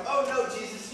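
high-pitched acted objection; mock protest. Voicing Peter protesting to Jesus.

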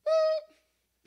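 high reversing beeps; squeaky beep-beep of a vehicle in reverse; beeping truck noise. Pretend. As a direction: mimic a truck backing up. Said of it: "back up".

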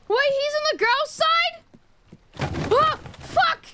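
high, loud startled yell; shocked shriek. Real, not acted. Gamer spotting a man in the women's bath, shocked shriek. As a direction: shriek it in disbelief. Pervert spotted on girl's side.

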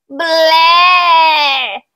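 loud, high, long "bleh"; disgusted "bleh". A narrator's reading. Child grimacing at the witch's ingredients, drawn-out disgusted bleh. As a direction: retch the word out in disgust. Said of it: "fece Pietro con una smorfia".

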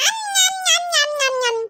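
smacking nyam nyam while gobbling food; greedy eating noises. Acted. Small child munching noisily at the beach. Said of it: "ஞம், ஞம், ஞம் தின்னுட்டே இருக்கான்".

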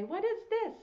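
normal talking voice

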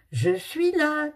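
put-on high, loud child's call. Little girl calling out to her parents as she opens the door. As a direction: announce yourself loudly through the door. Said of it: "cria Charlotte".

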